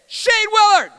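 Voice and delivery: high, booming call-out of a name; challenger's shout. Retold or imitated. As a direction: bellow it like a schoolyard challenger. Challenger calls him out to fight.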